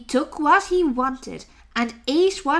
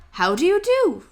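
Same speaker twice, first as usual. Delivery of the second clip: higher cheerful duck voice; polite duck; 5 semitones higher than usual. Acted. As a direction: greet him brightly and politely.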